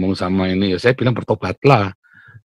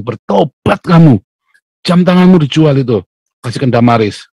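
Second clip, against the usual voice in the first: mock stern rebuke; 4 semitones higher, 6 dB louder than usual. Preacher rebuking his fellow pastor, mock stern and loud. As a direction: scold an old friend half in jest.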